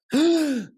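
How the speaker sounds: sharp loud gasp, jolting awake; startled gasp. Retold or imitated. Waking with a jolt.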